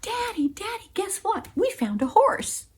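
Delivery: high-pitched, bursting with news; eager, high little-girl voice; excited child. Acted. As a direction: call to Daddy with thrilling news. Child bringing big news home.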